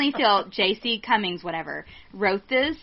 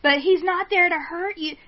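usual speaking voice

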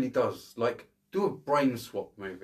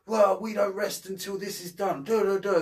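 mock Travolta cop; 8 semitones higher, 3 dB louder than usual. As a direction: parody a gung-ho movie cop barking orders. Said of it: "he's all like".

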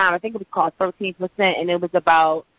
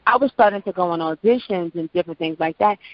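ordinary talk, nothing marked

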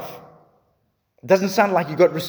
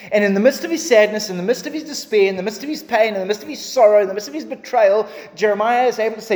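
higher and louder, building preacher's cry; impassioned preaching; 6 semitones higher, 4 dB louder, faster than usual. Acted. Preacher crying out to his congregation, impassioned. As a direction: pour it out over the pain.

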